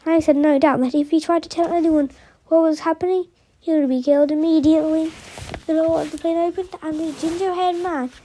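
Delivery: usual speaking voice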